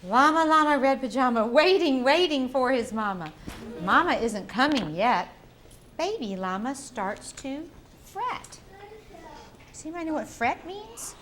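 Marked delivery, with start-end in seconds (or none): 0.0-8.6 building, louder storybook suspense; rhythmic read-aloud for little kids; reading to a toddler. Acted. Reading picture book to children.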